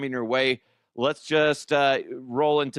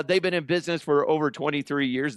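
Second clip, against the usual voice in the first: ordinary talk, nothing marked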